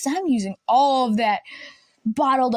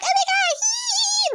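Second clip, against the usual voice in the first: excited squeal; slower than usual. Retold or imitated. Second floor kids rushing outside, giddy squeal. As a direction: squeal like a thrilled kid. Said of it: "second floors are like".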